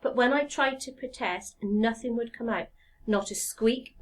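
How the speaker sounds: ordinary delivery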